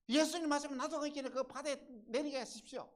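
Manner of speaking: high, loud, urgent request; excited shouted appeal; Peter's eager plea. Acted. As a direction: call out eagerly across the water. Acting Peter's plea to Jesus.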